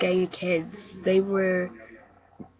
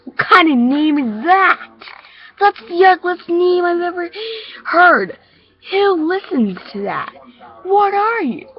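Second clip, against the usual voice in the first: high, loud, scornful mockery; jeering bully; 11 semitones higher, 10 dB louder than usual. Bully mocks a new kid's name, loud jeering. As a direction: ridicule them at full voice, pitched way up.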